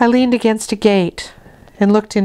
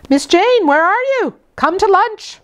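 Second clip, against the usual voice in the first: calling out; 14 semitones higher than usual. A narrator's reading. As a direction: call across the garden to the girl. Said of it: "heard a clear voice call".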